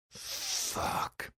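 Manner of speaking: breathless whispered Fuck; whispered curse. A narrator's reading. Caught out by Nisha, a whispered curse. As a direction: hiss Fuck under your breath.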